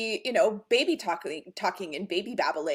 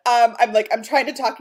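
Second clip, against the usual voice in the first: usual speaking voice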